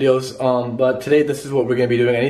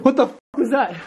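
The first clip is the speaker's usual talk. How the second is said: startled; 11 semitones higher than usual. Real, not acted. Log falls.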